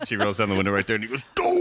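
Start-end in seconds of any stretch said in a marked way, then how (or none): 1.3-1.6 short, very high loud yelp; imitated cartoon yell. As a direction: mimic a cartoon outburst at full volume. Imitates the cartoon man's cry.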